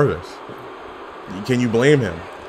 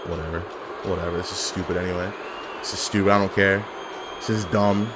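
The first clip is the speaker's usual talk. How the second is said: sulky mutter; 7 semitones lower, 9 dB quieter, faster than usual. Real, not acted.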